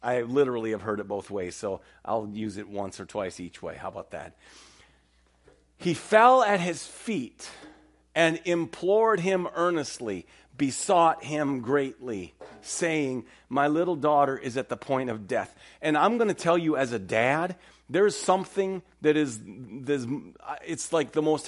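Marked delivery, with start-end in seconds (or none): 5.8-15.5 slow, raised, earnest reading of the passage; solemn scripture reading. Acted. A preacher reading Jairus's plea aloud to the congregation, solemn. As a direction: lift the verse up slowly and gravely.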